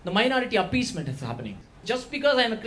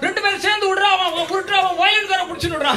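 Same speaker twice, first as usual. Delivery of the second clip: excited Tambram mama; 9 semitones higher, 3 dB louder, much faster than usual. Retold or imitated. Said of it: "Tambra mamas".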